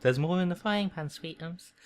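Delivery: put-on high-pitched accent for a fussing aunt; Aunt Petunia. Aunt to her son at breakfast, doting impression. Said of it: "That's a good accent".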